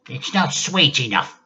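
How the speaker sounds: grandma demands tea from George, bossy and cranky. Acted. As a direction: order the boy about like a cranky old lady. Grandma voiced in story.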